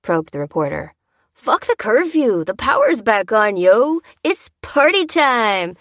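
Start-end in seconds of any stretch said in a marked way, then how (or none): 1.3-5.8 high, drawn-out rowdy shout; excitable yell. A narrator's reading. Excitable reveller defying the reporter's curfew question, yelling. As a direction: yell defiantly like a partying reveller. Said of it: "yelled the more excitable one".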